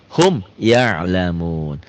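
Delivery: slow, melodic tajwid chanting; chanted Quranic reading; Quran recitation. Shown as a demonstration. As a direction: recite it slowly with drawn-out vowels. Recites example slowly.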